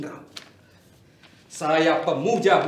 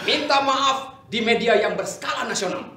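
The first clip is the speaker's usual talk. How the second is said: mocking laugh; 7 semitones higher, 6 dB louder than usual. Mocking demand to rival.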